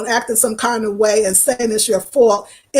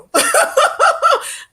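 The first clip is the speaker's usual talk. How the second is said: a sudden high squeal of a laugh; high burst of laughter. Real, not acted. She pictures her joke t-shirt and bursts into a high laugh.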